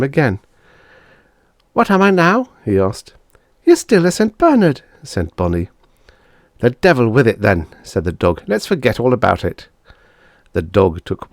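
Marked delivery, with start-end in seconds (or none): 1.7-4.8 put-on high, louder kids' voices; children checking the result. Siblings, high loud voices. 6.6-9.7 put-on louder resigned boy; a little boy throwing up his hands; giving-up boy. Bob as dog giving up, resigned voice.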